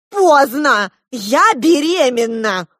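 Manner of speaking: high, loud, drawn-out outburst; crying out. A narrator's reading. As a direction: shout it out like a verdict. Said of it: "выкрикнула Диана". (громко выкрикивая)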